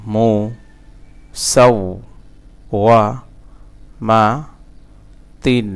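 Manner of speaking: "مو সাওওয়ামাতিন" with a long pause after each syllable; broken syllable-by-syllable. Shown as a demonstration. Teacher breaking the word for learners, syllable by syllable. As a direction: chop "مو সাওওয়ামাতিন" into slow pieces. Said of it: "ভাঙা ভাঙা".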